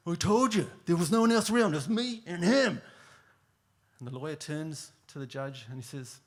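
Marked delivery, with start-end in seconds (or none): 0.1-2.8 loud, high, impatient repeat; fed-up trucker. Retold or imitated. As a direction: say it like the third time of asking. Fed-up driver repeats to lawyer.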